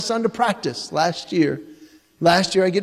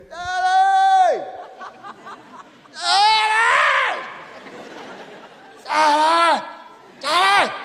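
imitating a little boy shouting for his dad; child calling out; 12 semitones higher, much slower than usual. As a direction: call up the stairs like a small boy. Son calling out at night.

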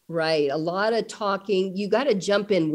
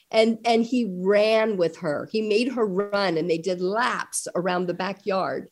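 normal talking voice

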